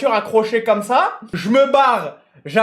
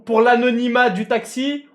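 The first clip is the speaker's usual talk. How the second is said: ordinary talk, nothing marked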